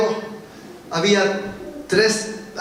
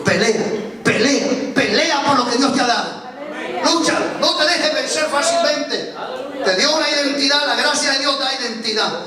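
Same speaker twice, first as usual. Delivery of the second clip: raised, slowed, emphatic sermon delivery; fiery preaching; 6 semitones higher than usual. Acted. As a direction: preach it out to the congregation, pitch climbing. Prediger steigert sich predigend.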